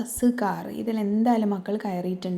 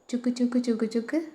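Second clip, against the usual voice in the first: high, rhythmic chuk-chuk of a train; train chugging; 4 semitones higher than usual. Retold or imitated. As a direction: puff it out like a little steam train. Imitating the train's sound.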